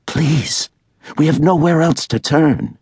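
put-on frightened young woman asking for help; Allie pleading. A narrator's reading. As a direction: beg urgently for help. Allie begging.

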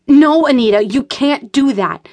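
loud, high pleading no; alarmed refusal; urgent protest. A narrator's reading. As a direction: stop her before she calls. Rachel stops Anita calling police.

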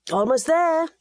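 a father calling out that they're nearly there. A narrator's reading. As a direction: call out so the riders behind can hear. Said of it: "Edgar called out".

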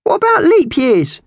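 very high, loud magpie voice; demanding squawk. A narrator's reading. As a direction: demand it at a piercing pitch. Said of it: "Slasher demanded".

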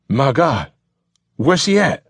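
high, rising panicked question; panic-stricken. Acted. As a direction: ask it in sudden panic. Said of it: "he asked in a panic-stricken voice".

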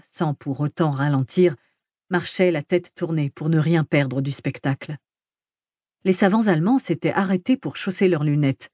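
ordinary delivery, nothing marked

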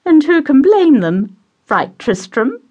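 high, fluttery mother voice; high, gushing matron; doting society mother. Acted. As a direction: play the gushing hostess pouring tea.

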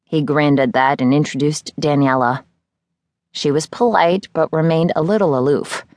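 ordinary delivery

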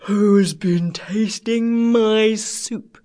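very slow, drawn-out big bear; great big bear voice. A narrator's reading. Great big bear finds his soup tasted, in a great big voice. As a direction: boom it out slowly as a huge bear. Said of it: "he cried in a great big voice".

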